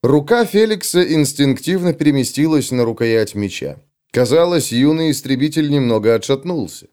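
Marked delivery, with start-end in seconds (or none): none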